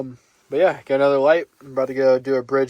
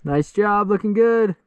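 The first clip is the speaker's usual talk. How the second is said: high, loud encouragement; cheering runners on; 7 semitones higher, 4 dB louder, slower than usual. Real, not acted.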